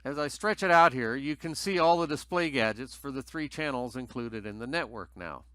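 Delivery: ordinary talk, nothing marked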